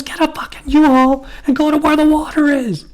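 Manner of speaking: high, loud sustained yell; retold comic rant. Comedian telling people to move to water, high mock screaming. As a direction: scream the punchline like a stand-up. Acted routine of comedian yelling.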